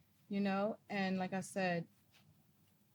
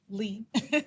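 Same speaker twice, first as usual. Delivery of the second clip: short laugh; 4 dB louder than usual. Real, not acted. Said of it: "move smartly".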